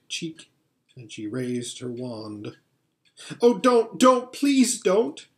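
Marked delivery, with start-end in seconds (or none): 3.4-5.3 high, urgent begging cry; drawn-out, high-pitched plea; pleading shout. A narrator's reading. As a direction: beg desperately in a raised boy's voice. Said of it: "shouted Edmund".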